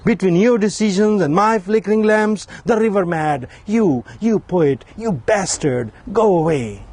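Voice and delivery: lifted, measured recital voice; poetry reading. Acted. Poet reading his own poem to an audience, recital voice. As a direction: declaim each image to the audience.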